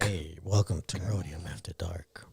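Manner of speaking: whispering. Pretend. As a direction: whisper the show intro back. Mock whisper echo of intro.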